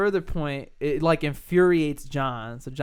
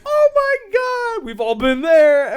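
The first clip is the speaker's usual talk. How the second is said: loud, high, drawn-out exclamation; hammed-up slow cry of dismay; mock bar-owner wail; 10 dB louder, slower than usual. Mimics bar owners' wailing reaction.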